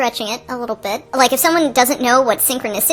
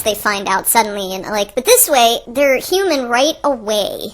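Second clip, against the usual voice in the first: ordinary talk, nothing marked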